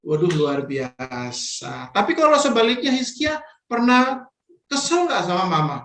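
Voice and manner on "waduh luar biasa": slow, low, drawn-out wonder; drawn-out praise. Acted. Teacher praising a child's answer, slow amazed drawl. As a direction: stretch it out like a delighted teacher.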